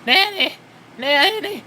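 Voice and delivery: high, loud mocking whine; shrill Stephanie. Mocking Stephanie scolding her father, high shrill whine. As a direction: squeal it to make fun of her. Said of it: "In that shrill voice of hers".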